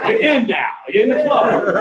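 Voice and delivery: loud, high laughing welcome; laughing announcement. Acted. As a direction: welcome him in while cracking up. Mock initiation announcement.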